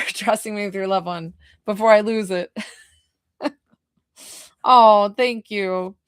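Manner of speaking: ordinary talk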